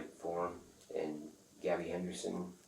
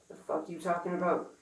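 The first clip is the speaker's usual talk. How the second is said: retold angry retort; 12 semitones higher, 3 dB louder than usual. Retold phone argument.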